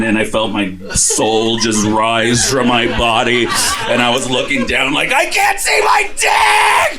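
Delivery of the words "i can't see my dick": high, loud, stretched shriek; panicked high shout. Retold or imitated. High man panicking as his soul floats, shrieking. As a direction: scream it in sudden panic.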